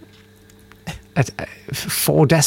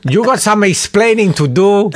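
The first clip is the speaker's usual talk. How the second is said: put-on higher, louder Mexican accent; 9 semitones higher, 4 dB louder than usual. Scolding the friend in a Mexican accent. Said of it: "I'm doing a Mexican accent".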